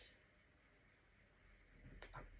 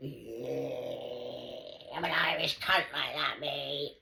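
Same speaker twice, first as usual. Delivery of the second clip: louder, boastful mock of Don; 8 dB louder, much slower than usual. Mimicking Don bragging he is Irish, louder. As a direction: ham up his cocky brag. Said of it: "Don's reply quoted".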